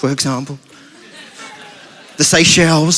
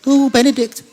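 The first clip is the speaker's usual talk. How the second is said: put-on swooning girl; 5 semitones higher than usual. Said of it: "Little bows in her hair".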